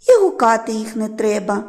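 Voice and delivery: ordinary delivery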